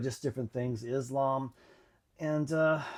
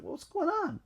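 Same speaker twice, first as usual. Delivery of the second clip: much higher, put-on questioning voice; puzzled friends. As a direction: quiz him in mock confusion.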